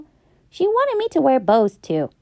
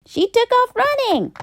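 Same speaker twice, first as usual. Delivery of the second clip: excited; 11 semitones higher than usual. A narrator's reading. Dramatic turn in story.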